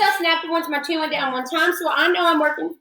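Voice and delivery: normal talking voice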